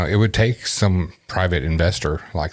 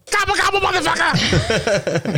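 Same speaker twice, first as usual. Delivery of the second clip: Mike Howard impression. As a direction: squeal it way up high. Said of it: "Mike Howard?".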